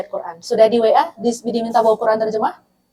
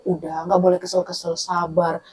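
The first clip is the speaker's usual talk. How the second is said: low, soft mock hushing; dismissive soothing; 6 semitones lower, 8 dB quieter than usual. Listener telling an annoyed person to be patient, hushing softly. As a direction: imitate someone calming down an upset friend. Imitates someone hushing an upset friend.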